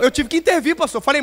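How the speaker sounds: ordinary delivery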